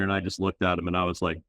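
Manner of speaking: usual speaking voice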